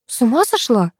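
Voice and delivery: understated startled retort; exclaimed disbelief. A narrator's reading. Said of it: "воскликнула Оля".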